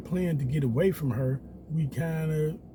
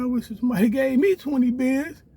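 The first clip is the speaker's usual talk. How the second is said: high, louder jealous mock; mimicking the niece; 9 semitones higher, 6 dB louder than usual. Niece envying the cash gift, high mocking whine. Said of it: "Her niece over there like".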